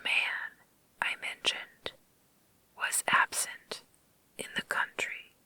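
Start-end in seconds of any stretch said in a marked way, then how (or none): none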